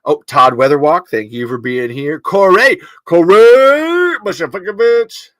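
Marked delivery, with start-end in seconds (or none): 2.2-5.3 loud, high teasing shout; mock outrage. Real, not acted. Host loudly teasing a chatter by name in mock outrage. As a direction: yell a friend's name in fake fury. Playfully cursing a chat friend.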